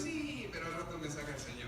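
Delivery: low, soft, amused reply; Pablo risueño. Acted. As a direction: answer softly, quietly sure of release. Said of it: "y Pablo riendo así". (Pablo confiado, en voz baja)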